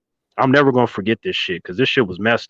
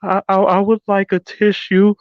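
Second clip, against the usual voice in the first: put-on tearful girl; 8 semitones higher than usual.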